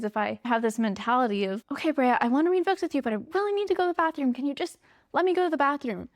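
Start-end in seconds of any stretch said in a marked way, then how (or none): 1.7-6.1 higher, very fast anxious pleading. Retold or imitated. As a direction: rush it out, nervous and pressed. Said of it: "Then it's just anxious energy".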